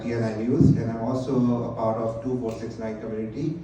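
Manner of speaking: ordinary talk, nothing marked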